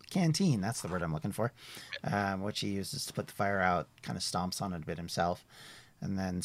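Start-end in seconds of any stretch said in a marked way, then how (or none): none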